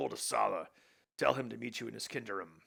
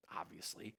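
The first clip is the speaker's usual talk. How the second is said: whisper; 11 dB quieter than usual. Acted. Whispered aside.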